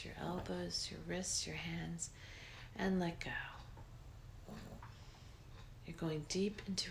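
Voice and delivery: breathy guided relaxation, unhurried; soft spa whisper. Acted. Leads a guided meditation.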